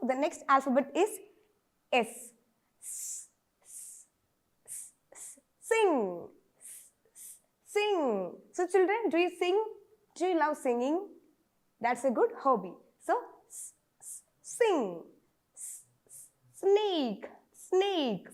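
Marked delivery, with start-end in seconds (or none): 2.8-5.4 voiceless hiss for "S, s". Shown as a demonstration. Teacher shows the s sound with a long hiss. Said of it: "the sound of S is S". 15.5-16.3 voiceless hiss for "S, s". Shown as a demonstration. Teacher shows the s sound with a long hiss. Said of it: "the sound of S is S".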